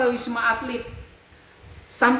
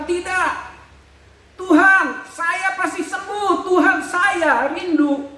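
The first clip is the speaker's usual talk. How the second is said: high, slow desperate plea to God; pleading prayer; 8 semitones higher, slower than usual. Retold or imitated. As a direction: beg God slowly, voice raised. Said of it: "Dalam hati saya, saya sempat bilang". (doa memohon, pelan dan tinggi)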